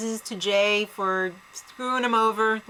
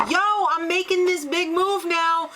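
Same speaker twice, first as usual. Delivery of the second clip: higher-pitched brash announcement; mock boasting; 6 semitones higher than usual. Player bragging to the jury about a big move, mock boastful. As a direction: call it out like an oblivious show-off.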